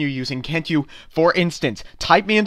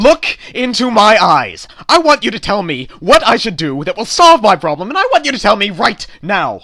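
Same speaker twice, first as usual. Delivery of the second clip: loud demanding; 5 semitones higher, 7 dB louder than usual. Acted. Customer losing patience with the clerk, loud and commanding. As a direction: order her to answer now.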